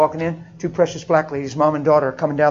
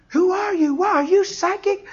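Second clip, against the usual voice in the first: startled; 12 semitones higher than usual. Retold or imitated. Girl startled by a stranger.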